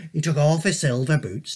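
ordinary delivery

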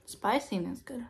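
talking between bites of puffs; savoring snack while speaking; chewing with mouth full. Real, not acted. Seen 0: Closed-lip chewing after bites; holds orange puff, bites at 4.3.

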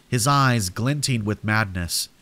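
usual speaking voice

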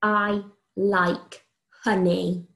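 very slow, each word spaced out; slow dictation. Shown as a demonstration. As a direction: dictate it slowly for children to write. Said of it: "first sentence".

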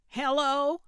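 loud, high holler into a walkie-talkie. A narrator's reading. Gertrude testing her walkie-talkie on Calvin, hollering. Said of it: "Gertrude hollered into her walkie".

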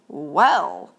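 low, pleased drawn-out well; with delight. Acted. Mouse delighted by the blazing fire, pleased well. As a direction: savour the word with delight. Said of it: "said Mouse with delight".

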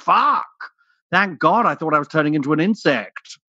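high, loud burst of relief that fades; relieved outburst. Retold or imitated. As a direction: blurt it out in relief, then calm down. Relief after doctor's correction.

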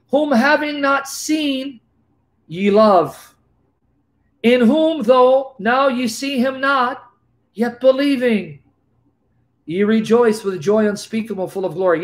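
scripture reading. Acted. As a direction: read the verses like holy writ. Reads Peter's verse slowly.

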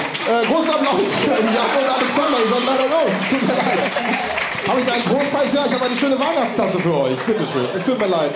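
bright announcer sending off contestants; game show host. Acted.

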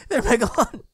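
high-pitched puppet chatter; high puppet voice, chiding lightly; puppet giving advice. Pretend. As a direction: advise as a squeaky cartoon sidekick. High puppet advises the monster.

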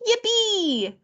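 put-on high, gleeful little-girl squeal; excited squeal. A narrator's reading. Lulu hears they will make lemonade, squealing. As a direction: squeal it with delight. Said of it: "squealed Lulu".

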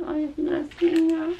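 high, slow cooing over something cute. Real, not acted. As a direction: coo at it like something adorable.

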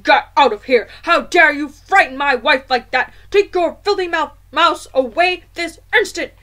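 loud, raging father; furious shouting. A narrator's reading. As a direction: roar at them to leave. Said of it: "towering over us and shouting".

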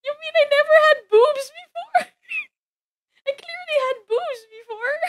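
high, mock-hurt squeak; mock crying squeak. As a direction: whine as if wounded. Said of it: "I may sound like I'm crying".

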